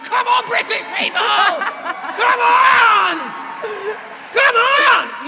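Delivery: high, loud laughing rally cry; rousing shout. Retold or imitated. Answering strangers in the street, rousing shout. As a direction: whip up the crowd at full volume. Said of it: "And I'm like".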